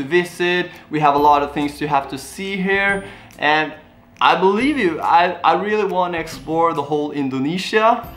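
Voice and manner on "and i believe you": normal talking voice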